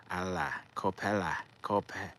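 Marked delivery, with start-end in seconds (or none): none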